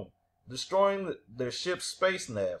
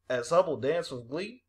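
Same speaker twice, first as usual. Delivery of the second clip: usual speaking voice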